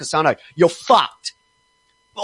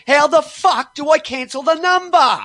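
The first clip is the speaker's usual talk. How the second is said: higher, shouted, desperate question; frustrated shout; 8 semitones higher than usual. Retold or imitated. Forum people asking how to cancel, shouting in frustration. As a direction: yell it like a fed-up forum poster.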